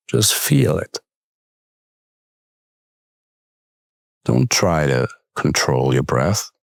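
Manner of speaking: calm, unhurried meditation guide with long pauses; guided meditation. Acted. Coach leading listeners with closed eyes into breath awareness, calm meditation voice.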